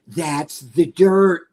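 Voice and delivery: very slow, stretched-out delivery; slow, deliberate mimicked explanation; imitating the priest. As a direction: draw each word right out like the priest. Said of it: "The way he said it".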